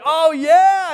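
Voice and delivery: loud, very high whoop; excited. Retold or imitated. Laban coming out to greet his nephew, excited. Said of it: "And Laban's excited".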